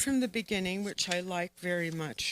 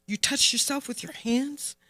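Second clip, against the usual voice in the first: higher, disbelieving rise to "hands"; scandalised nun's question, pitched up; tense repeated question; 5 semitones higher than usual. A narrator's reading. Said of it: "She repeats".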